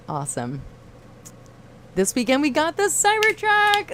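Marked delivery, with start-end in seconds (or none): 2.0-3.9 very high, loud gush; thrilled squeal. Real, not acted. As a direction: squeal with delight. Reading Cybertruck news.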